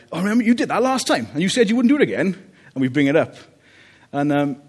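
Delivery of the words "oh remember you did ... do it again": high, fast mock nagging at the kids; nagging parent. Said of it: "we're like".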